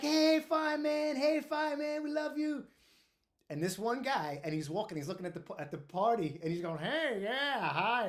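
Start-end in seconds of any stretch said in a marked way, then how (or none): 0.0-2.6 put-on party girls calling. Girls at the party calling to a fireman, high mock cheering. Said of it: "there's a bunch of girls out". 6.8-8.1 loud, higher, swooping show-off hello; mocked guy's voice. Prankster mocking his buddy greeting the party, put-on preening voice. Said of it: "That's how we made him talk".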